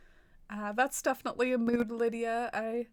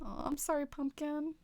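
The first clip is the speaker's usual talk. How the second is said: tender; 4 semitones higher, 5 dB quieter than usual. Real, not acted. Comforting her old cat.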